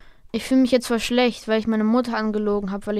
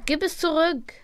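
upset plea; 6 semitones higher than usual. Acted. Kippa taken, pleading.

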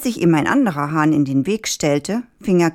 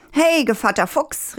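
calling out; 8 semitones higher than usual. A narrator's reading. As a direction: hail the fox in a raised pitch. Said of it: "rief der Hahn". (hoher Zuruf, ohne zu schreien)

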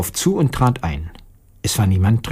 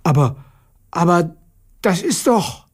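halting astonishment, climbing higher; astonished; 6 semitones higher than usual. A narrator's reading. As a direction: falter in amazement, pitch rising with each try. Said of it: "rief erstaunt". (erstaunt stockend, immer höher)

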